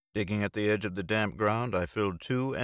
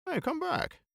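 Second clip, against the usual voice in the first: high call after someone. A narrator's reading. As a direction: call him back across the room. Bill calling after him.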